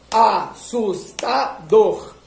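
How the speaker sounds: "Assustador" said syllable by syllable. Shown as a demonstration. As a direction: clap "Assustador" out slowly. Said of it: "enquanto você tá falando as sílabas".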